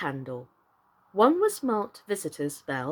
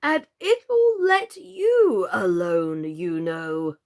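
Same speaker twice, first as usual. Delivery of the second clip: louder, very slow frog; panting frog; 4 dB louder, much slower than usual. A narrator's reading. Old frog pants advice to Alice as he hobbles off. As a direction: puff the words out breathlessly. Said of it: "he panted out".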